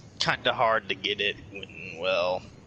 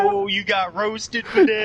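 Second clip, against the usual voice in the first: much higher, slowed, sing-song gloat; gloating taunt; 12 semitones higher, 4 dB louder than usual. Retold or imitated. Sonic gloating at Fidel over the roast, teasing. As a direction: rub it in with glee.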